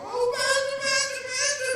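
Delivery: high, pleading call of her pastor; emotional church lady. Retold or imitated. Sister Brenda calling her pastor, high and emotional. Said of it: "she's real colorful".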